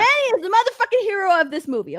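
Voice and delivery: high, quick, emphatic rave; excited championing. Real, not acted. Fan telling viewers who the movie's hero is, excited championing.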